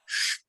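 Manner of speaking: a soft, long "Sh"; sh sound. Shown as a demonstration. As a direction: hiss out the "Sh". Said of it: "is pronounced as sh".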